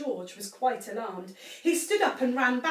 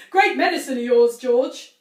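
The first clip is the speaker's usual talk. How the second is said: gloating; 12 dB louder than usual. A narrator's reading. Mr Cranky gloats.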